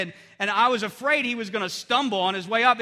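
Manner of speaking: normal talking voice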